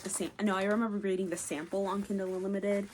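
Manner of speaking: ordinary delivery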